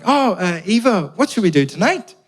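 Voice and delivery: high, keen friendly question; eager and hopeful. Retold or imitated. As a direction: ask brightly, hoping to fit in. Eager greeting new flatmate.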